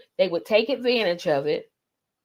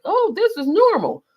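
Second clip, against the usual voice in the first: put-on high-pitched, naive child; mock kids' voice; 11 semitones higher, 4 dB louder than usual. Retelling how the kids reacted to the man, high mock-child voice. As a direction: imitate kids going along with it, pitched way up. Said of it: "the kids were like".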